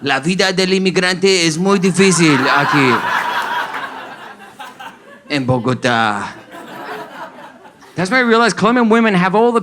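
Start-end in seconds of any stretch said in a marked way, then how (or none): 0.0-6.3 put-on French-accented Spanish, falling away at the end. French immigrant bemoaning life in Bogotá, French-accented Spanish. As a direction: lament like a hammed-up Frenchman. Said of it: "And he was like".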